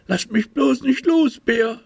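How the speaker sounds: a small anxious tiger clinging on; little tiger voice. A narrator's reading. Little tiger begging bear not to let go, high childlike voice.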